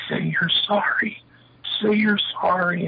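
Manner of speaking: high, drawn-out mock scolding; demanding an apology. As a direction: imitate a chief insisting on an apology, high and slow. Chief demands an apology in quarrel.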